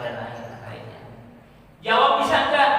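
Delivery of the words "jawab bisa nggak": high, loud rhetorical question; loud challenge. Acted. Preacher challenging the congregation about the grave questions, loud and high. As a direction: throw it at the listeners.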